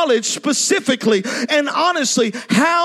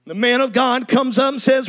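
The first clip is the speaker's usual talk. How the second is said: normal talking voice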